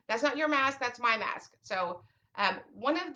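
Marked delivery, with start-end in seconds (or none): 0.1-1.5 put-on squabbling kid. Kids squabbling over masks.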